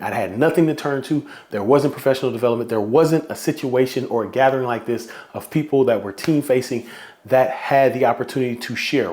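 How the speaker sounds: ordinary delivery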